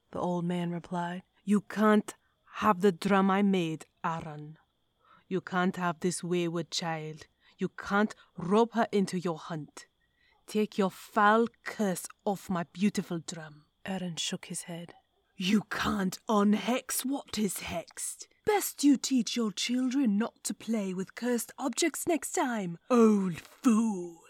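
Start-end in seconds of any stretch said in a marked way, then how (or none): 1.4-13.7 stern scolding. Acted. Elder scolding the god Aaron. 15.4-24.2 higher, louder, a god mocking and yelling; screamed. A narrator's reading. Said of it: "he screamed".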